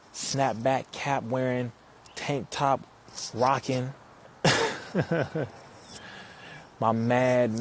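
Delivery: ordinary talk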